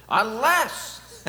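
put-on starting a Polish accent, breaking into a laugh; a high, loud Polish-accented opening word, laughing; John Paul II accent. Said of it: "how can I not use his accent?".

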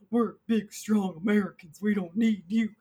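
loud, chest-out mock soldier declaration; mock military. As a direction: play a blustering military man, punching each word. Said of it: "the military is like".

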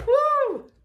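high-pitched "woo" of joy; excited whoop. Real, not acted. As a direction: let out a spontaneous high whoop. Moved by the scripture just read.

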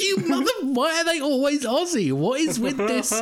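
high-pitched, hammed-up outraged rant; retold comic outburst of disbelief; mock exasperation. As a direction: wail the complaint in mock despair. Retold exasperation at Australian actors.

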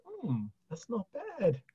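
mock modest pleasure; imitating mum. Mother voiced, modestly pleased.

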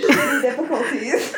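laughing through words. Real, not acted. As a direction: crack up on it. Laughing pun.

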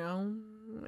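very soft meditative "om"; hushed mock chant; meditation hum. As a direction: imitate a quiet meditating drone. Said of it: "meditating in his little corner going".

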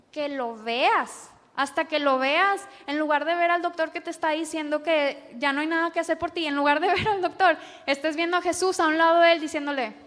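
predicando con fuerza. Real, not acted. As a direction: rise into an impassioned sermon peak. (predicación apasionada y más fuerte)

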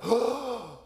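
acted shocked gasp and "oh"; cold-water gasp. Dad getting into the chilly lake, gasping.

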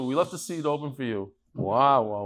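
normal talking voice

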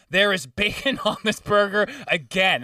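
retold indignant protest; mock exasperated customer. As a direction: imitate a customer who is fed up, hitting each word. Retold customer complains a second time.

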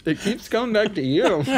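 high, laughing through words. Real, not acted. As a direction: giggle it out.

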